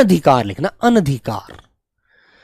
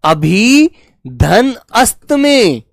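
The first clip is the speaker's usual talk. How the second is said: syllable by syllable; much slower than usual. Shown as a demonstration. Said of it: "इसको हमने दो भागों में तोड़ दिया".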